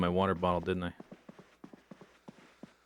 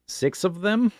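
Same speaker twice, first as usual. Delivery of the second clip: high, loud disbelieving question; surprised; 10 semitones higher, 5 dB louder than usual. Real, not acted. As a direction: blurt it out, startled by the count. Finds six of one die.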